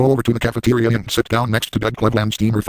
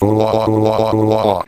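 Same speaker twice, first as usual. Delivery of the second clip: exaggerated louder mock moans; mock moaning; 4 dB louder than usual. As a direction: moan it out loud as a joke. Moaning while using the dildo.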